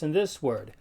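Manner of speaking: normal talking voice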